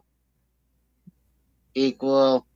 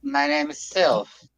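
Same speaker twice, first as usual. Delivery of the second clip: much higher, slow, sweetly answering; slow higher reply; 10 semitones higher than usual. Acted. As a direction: answer like a shy child. Answering the panda character.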